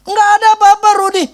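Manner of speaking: very high, loud brush-off; dismissive pastor. Retold or imitated. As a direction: sing it out high like nothing happened. (pendeta menepis dengan suara sangat tinggi dan keras)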